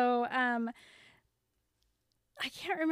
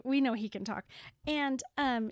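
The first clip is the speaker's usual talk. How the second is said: ordinary talk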